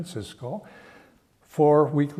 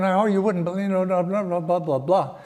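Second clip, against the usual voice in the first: higher-pitched mock rant; venting; 5 semitones higher, faster than usual. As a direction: rattle off an upset vent, retold. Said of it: "I started to vent".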